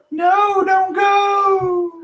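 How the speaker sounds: very high mock plea; mock wail. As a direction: wail it like a heartbroken kid. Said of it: "And I was like".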